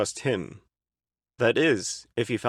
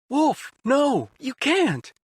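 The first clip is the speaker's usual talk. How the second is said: very high, loud pleading; pleading cry; 13 semitones higher, 3 dB louder, much slower than usual. A narrator's reading. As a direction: plead desperately. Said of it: "Otacon pleaded with her".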